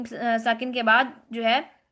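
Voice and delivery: usual speaking voice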